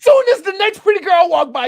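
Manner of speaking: loud, high jab at a co-host; heated teasing. Real, not acted. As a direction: start shouting, then ease off. Loudly mocking co-host in bickering.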